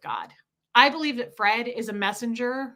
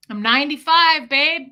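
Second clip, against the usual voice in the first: imitated old man's loud, higher boast; proud loud announcement; 4 semitones higher, 8 dB louder than usual. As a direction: announce the birthday loudly. Imitates Fred announcing his birthday.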